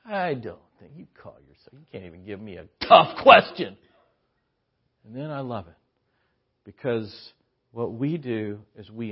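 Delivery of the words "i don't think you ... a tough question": mock taunt. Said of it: "the way he's acting".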